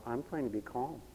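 soft, low mock serenity; pious, irritating calm. Preacher mimicking someone walking around falsely calm, pious. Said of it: "irritating calm".

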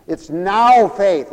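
"now" drawn out, loud and high; stress on NOW. Shown as a demonstration. Preacher quoting the Bible to the congregation, stress on NOW. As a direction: lean long and hard on "now". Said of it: "so you gotta say".

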